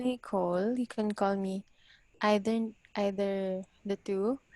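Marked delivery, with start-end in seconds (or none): none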